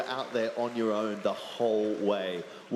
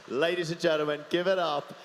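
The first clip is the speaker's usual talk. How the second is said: announcer introduction; 4 semitones higher than usual. Real, not acted. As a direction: bring the champion on like an MC. Presenter introduces champion to crowd.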